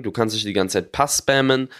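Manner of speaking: ordinary delivery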